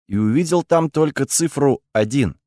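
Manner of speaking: plain reading voice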